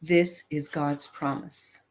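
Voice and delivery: normal talking voice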